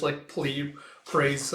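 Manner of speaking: ordinary delivery, nothing marked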